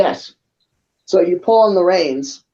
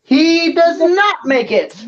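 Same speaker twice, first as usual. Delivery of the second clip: mock-dramatic announcement; 12 semitones higher, slower than usual. A narrator's reading. Dramatic narration.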